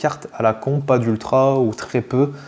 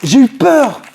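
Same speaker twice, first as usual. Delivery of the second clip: high, loud shout after a fright; yelled relief; 11 semitones higher, 7 dB louder than usual. Real, not acted. Unboxer admitting the scare to viewers, shouting. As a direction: let the scare burst out loudly.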